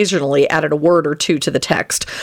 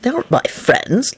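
raised, surprised denial; 5 semitones higher than usual. A narrator's reading. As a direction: deny it in puzzled surprise. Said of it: "in a surprised voice".

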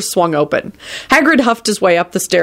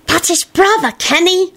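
shouted correction; 12 semitones higher than usual. A narrator's reading. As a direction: exclaim it in alarm, pitched up. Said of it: "shouted Teddy".

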